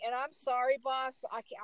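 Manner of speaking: ordinary delivery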